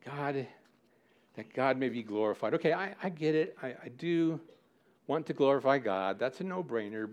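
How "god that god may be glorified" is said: ordinary talk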